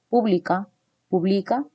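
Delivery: "Pública" stressed on pú, "publica" stressed on bli; stress contrast demo. Shown as a demonstration. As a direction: teach the shift from "Pública" to "publica". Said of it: "Son diferentes también aquí".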